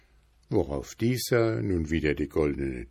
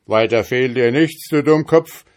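loud, higher furious devil yell; devil's shout; 4 semitones higher, 8 dB louder than usual. Acted. Trapped devil yells at the journeyman, loud and high. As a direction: bellow the insult at him. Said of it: "schrie der Teufel".

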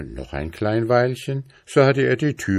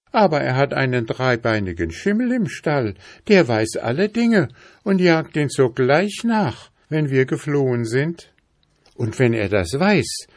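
higher-pitched princess voice; storybook princess, pitched up; worried princess; 7 semitones higher than usual. Acted. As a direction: warn him anxiously of the danger. Princess explains the danger.

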